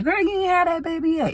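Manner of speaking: very high mock nosy voice; nosy relative. Mocking gossiping people who ask about the baby, high squeal. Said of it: "people saying".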